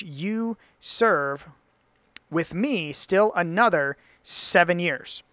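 slow, declamatory delivery, opening high then settling; dramatic scripture reading. Acted. Laban laying down his terms to Jacob, slow dramatic reading. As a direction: stretch out Laban's decree word by word.

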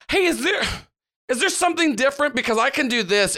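put-on high, quick curious girl voice; lightly voiced curious girl; Sammy voice. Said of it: "blinks in front of you and just goes".

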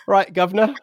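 put-on loud, high caricature London accent; hammed-up London greeting; mock London accent. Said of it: "a bit of your London accent".

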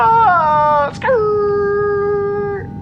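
high hammed-up yelp; mock crash scream. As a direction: yelp as the plane scrapes.

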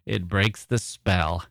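ordinary talk, nothing marked

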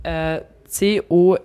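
slow letter by letter; spelling it out. Shown as a demonstration. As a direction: spell each letter, slowly. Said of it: "kannst du das mal buchstabieren".

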